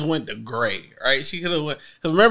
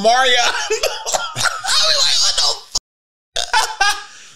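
laughing; much slower than usual. Retold or imitated. Laughing retold reaction.

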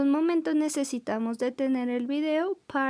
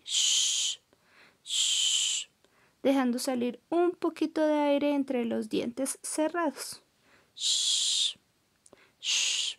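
hushing shh; slower than usual. Shown as a demonstration. Teacher demonstrating the sh sound as a gentle shush. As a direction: shush gently like asking for silence. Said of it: "como si le dijéramos a alguien que haga silencio".

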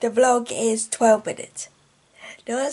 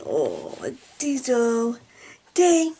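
ordinary delivery

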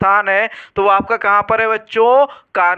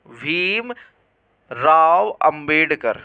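slow dictation; 4 semitones lower, much slower than usual. Real, not acted. Teacher writing out the full name for students, slow dictation. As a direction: stretch Bhimrao Ambedkar out very slowly, word by word.

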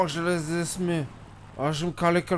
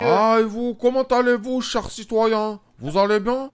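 overpolite greeting; 5 semitones higher, 5 dB louder than usual. Acted. Greeting the president.

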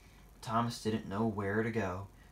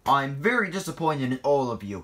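raised, louder scolding; stern; 5 semitones higher, 7 dB louder than usual. A narrator's reading. Boss scolding all the engines, sternly. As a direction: tell them off firmly. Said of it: "he said sternly".

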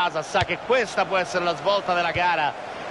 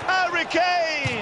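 name yelled long and high; excited shout; 10 semitones higher, much slower than usual. Real, not acted. Shouted chance in commentary.

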